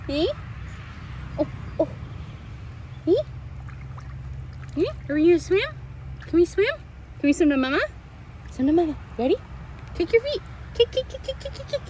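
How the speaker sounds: high, sing-song coaxing and chanting. Real, not acted. Instructor coaxing baby Sophie to swim to Mama, high chant. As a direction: cheer the little one across to mama.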